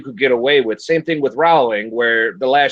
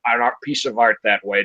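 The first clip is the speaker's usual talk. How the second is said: normal talking voice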